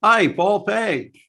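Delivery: high, drawn-out Australian hail; imitated Australian shout of greeting; Australian accent. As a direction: call out like an Australian fan, loud and slow. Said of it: "Australian accent".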